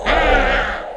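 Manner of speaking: very low, loud roaring ah; deep ogre roar. Acted. Storyteller roars as the ogre, very low and loud.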